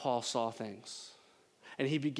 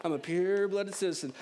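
Southern accent; 3 semitones higher than usual. Shown as a demonstration. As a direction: savour it in a hammed-up Southern accent. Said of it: "I just feel like that's how it should be read".

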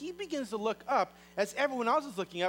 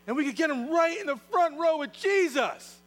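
excited pitch; 9 semitones higher, 6 dB louder than usual. Acted. Friend pitching his bold plan.

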